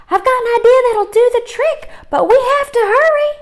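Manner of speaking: very high, loud eager cry; excited cry. Acted. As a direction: burst out with the idea. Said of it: "Liza cried".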